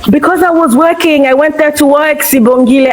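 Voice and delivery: real heated, high-pitched self-defence; heated protest. Real, not acted. Panelist defending herself to Sibongile, heated high-pitched protest.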